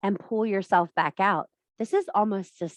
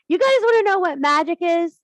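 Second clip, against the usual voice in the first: dramatic hook; 10 semitones higher, 7 dB louder than usual. Acted. Dramatic opener to the audience.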